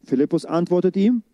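normal talking voice

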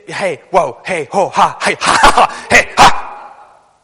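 loud, ecstatic cries of joy; joyful shouting. Real, not acted. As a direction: shout "hey" and "ha" in rising rapture.

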